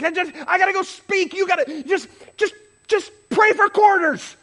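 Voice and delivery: high, stammering, exasperated shout; angry outburst. Retold or imitated. Preacher due to speak snapping at his wife, exasperated. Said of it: "actually starting to get mad".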